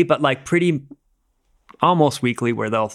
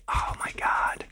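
soft voiceless oh my God; whispered dread; 8 dB quieter, slower than usual. Retold or imitated. Climber imagining breaking a bone again: hushed whisper. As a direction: breathe it out in quiet horror. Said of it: "you're like".

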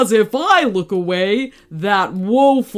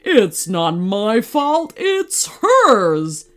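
roared; 5 dB louder, much slower than usual. A narrator's reading. Tiger blaming the unicorn, indignant roar. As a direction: roar the blame back, building. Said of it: "roared the tiger".

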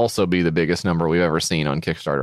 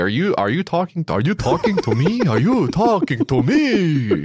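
high-pitched, escalating mock tough-guy challenge; hammed-up movie impression, pitched high; Joe Pesci; 8 semitones higher than usual. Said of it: "Joe Pesci".

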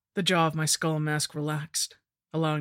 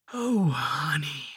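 slow whispered crone voice; a mock-sweet hag's whisper; cackling hag; slower than usual. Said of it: "cackled the hag".

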